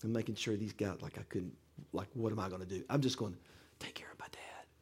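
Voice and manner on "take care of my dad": hushed, breath-only begging; whispered plea. Retold or imitated. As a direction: plead under your breath. Silently pleading with paramedics.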